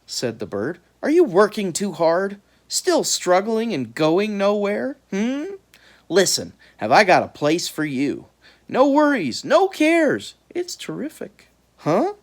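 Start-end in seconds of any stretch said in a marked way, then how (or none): 1.0-11.5 smooth high bird voice. A narrator's reading. The bird's sales pitch. 11.8-12.1 confused. A narrator's reading. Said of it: "He was confused".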